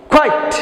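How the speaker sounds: কোয়াইট said clearly as kwait, ending in -ait; pronunciation model. Shown as a demonstration. Teacher modelling কোয়াইট for pupils to repeat. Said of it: "আইট উচ্চারণ হবে".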